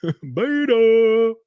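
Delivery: high, drawn-out comic cry; Jesse Lee Peterson. Acted. Streamer repeating a favorite personality's catchword, high drawn-out call. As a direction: stretch the word in a high put-on voice. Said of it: "God, I love Jesse Lee Peterson".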